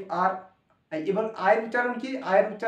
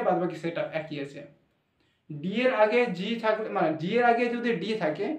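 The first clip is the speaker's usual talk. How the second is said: usual speaking voice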